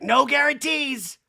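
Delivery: slow, high, loud mock Kyle; a hammed-up Kyle, drawn out. Recapping Kyle's answer in a high mocking voice.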